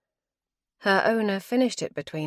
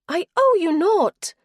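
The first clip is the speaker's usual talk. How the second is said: higher-voiced wife, cold and weary; wife's voice; 13 semitones higher, 9 dB louder than usual. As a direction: she refuses him, tired and final. Wife's voice again.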